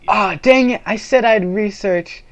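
loud, high, annoyed cry; frustrated outburst. Real, not acted. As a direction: blurt it out in frustration. Remembers forgotten task.

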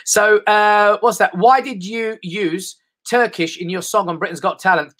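ordinary talk, nothing marked

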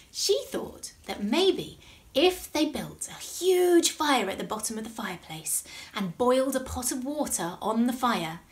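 ordinary delivery, nothing marked

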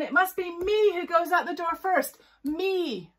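high, loud, self-important demand; bossy insisting. Acted. Unicorn demands to exit first, loudly bossy.

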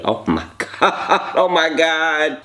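oh my god broken by a laugh. Real, not acted. Savoring the food, laughing. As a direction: laugh through it.

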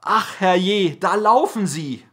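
high, loud shocked outburst; a baker's loud, high cry of alarm. A narrator's reading. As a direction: cry out as the baker spots them fleeing. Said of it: "der Meisterbäcker schrie".

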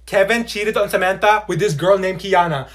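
ordinary talk, nothing marked

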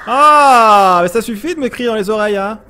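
loud high exclamation; exasperated cry; irritated outburst. Real, not acted. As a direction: snap at the noisy monster. Annoyed at screaming monster.

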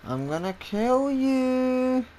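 high, slow, drawn-out threat; mock menace, called out slowly; playful taunt. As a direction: stretch the last word out high and held. Playful threat to hunted game character.

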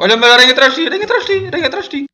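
high laughing alarm call; panicked warning. Real, not acted. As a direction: warn of the spider in a laughing panic.

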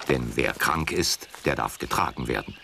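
plain reading voice

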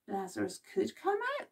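normal talking voice

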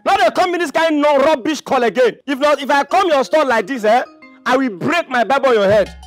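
high, loud, heated warning; angry threat. Acted. Caller threatened over coming again, angry threat. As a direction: hurl the threat at full voice.

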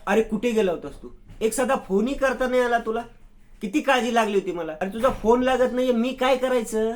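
high, loud, worried telling-off; wife snapping at her husband who came home late, angry and loud; angry scolding. A narrator's reading. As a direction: scold him for vanishing at night, voice climbing. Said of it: "साक्षी त्याच्यावर चिडते".

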